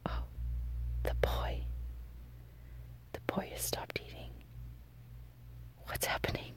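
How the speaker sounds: hushed whisper. Real, not acted. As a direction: whisper so the cat won't hear. Not disturbing the eating cat.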